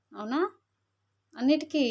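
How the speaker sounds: ordinary talk, nothing marked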